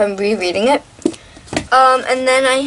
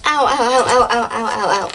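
in pain. Real, not acted. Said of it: "my knees hurt so bad".

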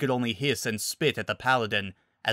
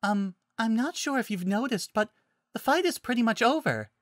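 high, polite little voice; shy, high-pitched correction; hesitant Fluttershy; 11 semitones higher than usual. Acted. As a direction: point it out timidly, high and light.

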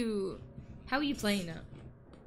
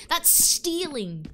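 mock outrage; 6 semitones higher, 3 dB louder, much slower than usual. Real, not acted.

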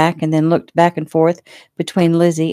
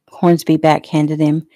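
ordinary delivery

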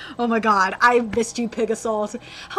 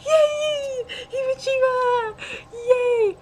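high, drawn-out yays; happy high-pitched squealing; delighted cheering; 14 semitones higher, slower than usual. Real, not acted. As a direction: cheer like a thrilled fan. Cheering the acceptance.

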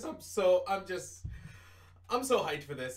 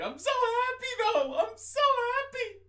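much higher, giddy squeal of joy; high excited squeal; 5 dB louder than usual. Pretend. Streamer squealing to viewers about the new game, high and giddy. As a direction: squeal it out like a thrilled fan.

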